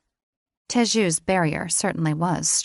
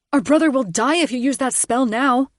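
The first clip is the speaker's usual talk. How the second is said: desperate male plea; 6 semitones higher, 6 dB louder than usual. A narrator's reading. Layden pleads urgently.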